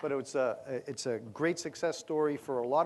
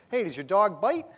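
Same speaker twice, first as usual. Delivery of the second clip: higher, louder nervous question; wary city visitor; 6 semitones higher, 7 dB louder than usual. Retold or imitated. Visitor calls to the local about his dog, higher and louder. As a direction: ask it loud and a bit jumpy.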